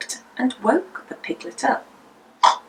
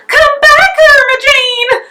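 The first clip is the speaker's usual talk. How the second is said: much higher, louder call after a runaway; crying out; 8 dB louder than usual. A narrator's reading. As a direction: shout across the yard to the escaping piglet. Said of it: "Missus Potter cried".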